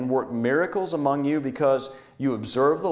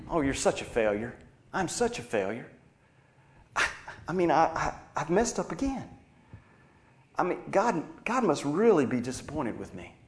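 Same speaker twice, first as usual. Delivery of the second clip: softer self-condemning voice, lightly acted; accuser's inner voice; 4 dB quieter than usual. Accuser speaking in your own voice, lightly acted self-condemnation. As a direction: imitate someone berating themselves, hesitant and softer. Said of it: "the accuser begins his assault. He says things like, in your voice".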